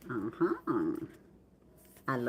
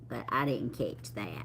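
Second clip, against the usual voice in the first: whispered aside; 6 semitones lower, 7 dB quieter than usual. Real, not acted. Aside while reading card names.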